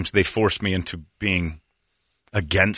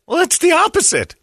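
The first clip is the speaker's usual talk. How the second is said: very high mocking voice; mock clinic staff; 5 dB louder than usual. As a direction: squeak it out very high.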